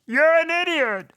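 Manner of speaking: sped-up squeaky chipmunk filter. Acted. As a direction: run it through a high chipmunk voice changer. Voice changer app on insult.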